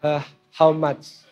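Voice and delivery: low, very slow, meek question. Retold or imitated. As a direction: drop the pitch and ask slowly. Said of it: "saya mulai turun".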